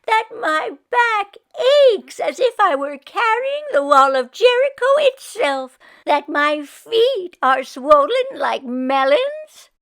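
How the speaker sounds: put-on high, loud wailing old woman; high, loud kvetching old woman wailing. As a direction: wail the complaints like a put-upon Yiddish grandma. Meshka wails her complaints again.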